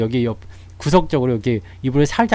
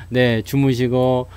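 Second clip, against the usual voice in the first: ordinary talk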